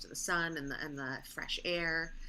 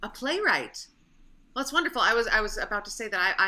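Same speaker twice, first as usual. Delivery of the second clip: excited; 4 semitones higher, 7 dB louder than usual. Acted. Nurse character excited.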